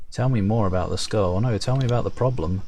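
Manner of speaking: ordinary talk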